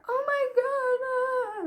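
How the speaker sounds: high, hammed-up emotional gasp; mock teary squeal. Retold friend's emotional reaction.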